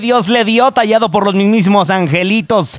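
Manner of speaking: high-pitched radio announcer build-up; grand introduction. Real, not acted. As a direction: build up the next star like a showman. (presentación grandilocuente de locutor, en tono agudo)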